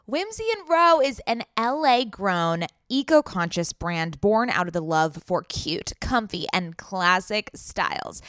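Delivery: polished promo copy with lifted selling points; ad read. Acted. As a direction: sell the clothing brand to listeners. Reads a sponsor ad.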